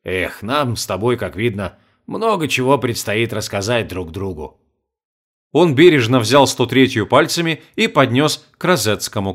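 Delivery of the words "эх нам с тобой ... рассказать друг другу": tender. Acted. Old man tender to friend.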